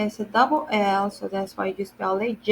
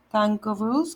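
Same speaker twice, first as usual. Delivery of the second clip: normal talking voice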